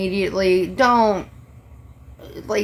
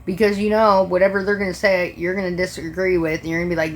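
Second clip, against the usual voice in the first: normal talking voice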